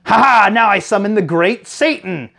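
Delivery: hammed-up villain cackle and proclamation; Rita Repulsa. Player retelling a Power Rangers episode, mock villain proclamation.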